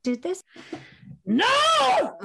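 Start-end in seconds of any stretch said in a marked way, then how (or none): 1.2-2.2 put-on shrill high child's scream of no. Boy refusing to hand over the iPad, screaming high. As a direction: scream it like a furious four-year-old. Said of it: "And Dan, age four, did this".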